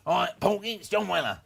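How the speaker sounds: a hint of a blunt old manager calling up; imitating John Weller. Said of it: "and he goes".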